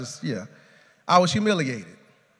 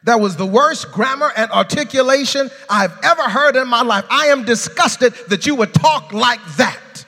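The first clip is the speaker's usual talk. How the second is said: scolding lady, louder and higher; disgusted church lady; 7 semitones higher, 7 dB louder than usual. Retold or imitated. Lady scolding the man after church, disgusted. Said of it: "She said".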